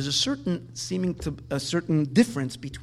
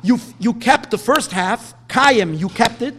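higher and louder, protesting to God; pleading complaint; 6 semitones higher, 8 dB louder than usual. Pretend. Avram complains to God about the broken promise, loud and higher. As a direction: voice Avram's protest with rising force. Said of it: "It could have said".

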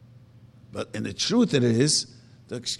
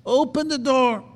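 pleading call; 10 semitones higher, 5 dB louder than usual. Acted. Shechina pleading at the door.

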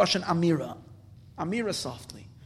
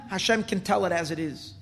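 normal talking voice

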